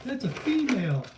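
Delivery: high mock-indignant bystanders; mock shocked bystanders. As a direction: ham up the outraged onlookers. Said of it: "they're all".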